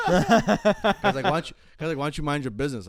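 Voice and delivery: high and loud, laughing through it; laughing retort. Retold or imitated. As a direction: crack up while telling him off. Telling off the dealer, laughing.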